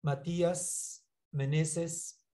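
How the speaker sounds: slow, drawn-out name call; to someone hard of hearing. Real, not acted. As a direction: stretch each syllable to be heard. Teacher calls unanswering pupil online.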